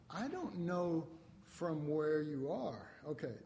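ordinary talk, nothing marked

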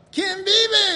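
high, yelled call to the crowd; loud yell. Retold or imitated. Preacher retelling his call to the Mexican church, yelled. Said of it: "And I yelled out".

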